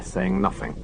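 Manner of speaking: usual speaking voice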